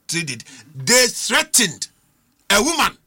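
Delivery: ordinary delivery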